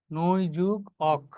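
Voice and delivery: নৈ যুগ অক said slowly, piece by piece; slow breakdown. Shown as a demonstration. Teacher building Naik from parts for students, slowly. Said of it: "Naik".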